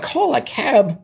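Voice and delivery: mock child disbelief; incredulous kid. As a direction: echo it in shock.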